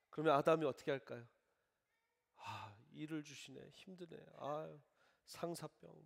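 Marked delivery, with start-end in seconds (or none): 2.4-6.0 tired grumble. Pretend. Adam grumbling at God's gift.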